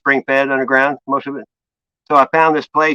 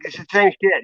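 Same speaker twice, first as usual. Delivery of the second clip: ordinary talk, nothing marked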